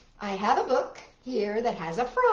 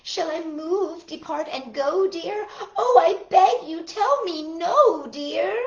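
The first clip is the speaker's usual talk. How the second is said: higher, drawn-out storybook plea; pleading turtle; 6 semitones higher, 6 dB louder than usual. A narrator's reading. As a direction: beg sweetly, stretching the key words. Turtle's plea in recited poem.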